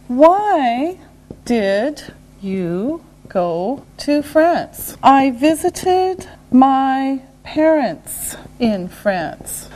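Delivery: word by word, with gaps; slow and deliberate. Shown as a demonstration. Teacher modelling a why question and answer, slowly. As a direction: draw out each word for learners.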